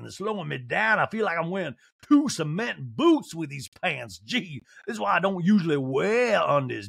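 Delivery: mock fussy grumbling; Sutton. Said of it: "She's like".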